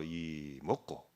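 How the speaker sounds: slow, low Gyeongsang dialect. Shown as a demonstration. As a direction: draw it out quietly in Gyeongsang dialect. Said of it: "경상도 말로".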